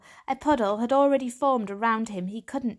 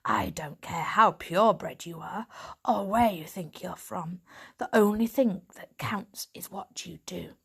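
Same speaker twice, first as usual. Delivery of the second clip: gravelly tomcat-like she-cat. Acted.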